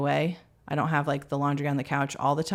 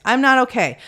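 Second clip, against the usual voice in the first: loud, raised-pitch declaration of overwhelm; saying it out loud; 7 semitones higher, 8 dB louder than usual. Retold or imitated. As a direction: announce it firmly, acting out a parent at breaking point. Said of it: "I will verbally say out loud".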